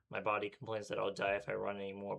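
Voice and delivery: plain reading voice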